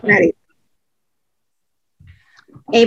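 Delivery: normal talking voice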